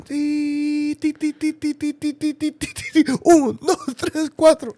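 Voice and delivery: high, rapid-fire tee-tee beeps; imitated machine noise. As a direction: squeak like a machine, bursting into a loud count. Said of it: "It would have gone".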